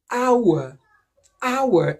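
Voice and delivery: "hour" with no H, starting on the vowel; silent H. Shown as a demonstration. Teacher showing H is silent, "hour" starting on a vowel. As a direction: say "hour" as "our", falling at the end. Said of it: "since the H is silent in hour".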